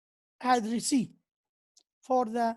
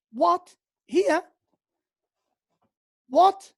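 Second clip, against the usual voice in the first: higher, louder astonished What?; exclamatory what; 4 semitones higher, 5 dB louder, much slower than usual. Shown as a demonstration. Teacher acting amazed reaction to proposal news. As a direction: burst out in disbelief. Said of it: "It is what?".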